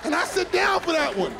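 high, incredulous outrage; outraged disbelief. Acted. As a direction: scoff at the absurd hour.

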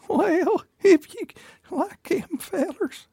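put-on high, shaky Southern old lady voice; old hag. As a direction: croak it like an old Southern granny. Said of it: "a Southern guy able to do an old lady voice".

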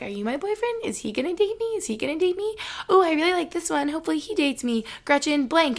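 high, eager questioning voice; hopeful mock inner voice. Vlogger imitating herself eyeing every guy, high and eager. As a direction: squeak it out like a hopeful crush. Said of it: "be like".

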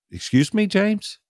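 high, rising disbelieving question; retold incredulous "excuse me?"; taken aback. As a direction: lift the pitch sharply in disbelief. Taken aback by friend's rebuke.

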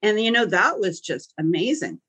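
ordinary delivery

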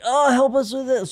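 high, pleading little voice of an aching body. Retold or imitated. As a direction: beg for help in a tiny high voice. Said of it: "my body was just, like, crying out for help".

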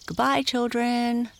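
high, singsong farewell call; calling goodbye. A narrator's reading. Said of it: "called the man".

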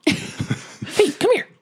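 high, squeaky coaxing voice; luring call. Acted. Player luring a goblin with a dead hand, high beckoning voice. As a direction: coax them over, high and puppet-like.

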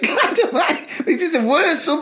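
high laughing disbelief; exasperated laugh. Retold or imitated. Fed up with the worst Subway, laughing high.

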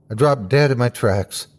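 plain reading voice

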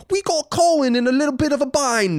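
mocking British listeners with a put-on accent. Imitating smug British listeners, mock British accent. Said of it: "You guys were being like".

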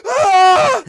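sudden very high, loud scream of panic; a startled scream from a sleeping driver; waking scream. Retold or imitated. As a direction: jolt awake screaming as if still driving. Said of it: "So I woke up screaming".

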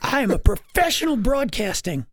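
very high put-on voice, mock self-importance; hammed-up high-pitched protest; indignant Brady. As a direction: imitate an affronted colleague, pitch way up. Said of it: "And Brady decided".

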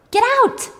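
high, loud yell; acted scream. Ellen throws Hannah's purse out, high acted scream. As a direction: yell it with the pitch jumping up. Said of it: "And Ellen screams".

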